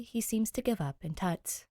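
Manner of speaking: usual speaking voice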